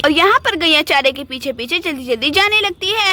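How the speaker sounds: story narration. A narrator's reading.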